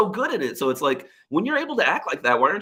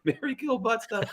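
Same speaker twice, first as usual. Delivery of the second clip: laughing; 12 semitones higher, 7 dB quieter than usual. Real, not acted. Joking game name, laughing.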